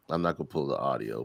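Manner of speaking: ordinary talk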